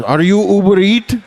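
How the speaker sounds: put-on high Indian accent, rising question; delivery guy Indian accent. Comic asks the customer in a put-on Indian accent. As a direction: mimic the delivery driver checking your name.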